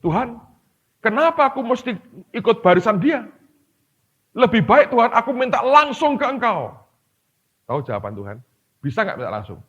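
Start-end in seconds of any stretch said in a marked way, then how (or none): 0.0-6.8 high, pleading protest; high, complaining plea to God; arguing back. Retold or imitated. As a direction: argue with God in a raised, whiny voice. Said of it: "dia masih bantah".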